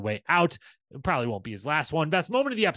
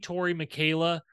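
ordinary talk, nothing marked

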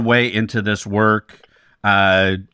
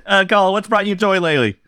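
usual speaking voice

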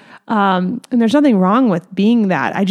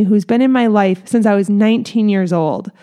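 ordinary talk

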